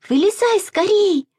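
put-on high, urgent little-girl shout; urgent call. A narrator's reading. Masha shouts to Sasha stuck in the stream. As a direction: call out to hurry him. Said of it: "закричала Маша".

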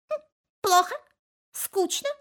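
chirpy, high bird voice; pecky little starling, high and bright. Acted. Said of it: "попикал и говорит". (высокий голосок скворца)

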